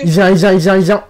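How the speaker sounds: panicked. Real, not acted. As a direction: call it out in panic. Monster coming, panicked call.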